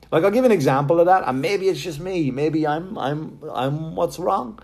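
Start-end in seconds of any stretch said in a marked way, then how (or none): none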